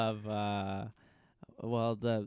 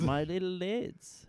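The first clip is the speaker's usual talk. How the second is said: higher, slow mock Liverpool accent; attempted Liverpool accent; 5 semitones higher, slower than usual. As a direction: draw out a bad Liverpool accent. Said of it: "I can't, I can't do an accent".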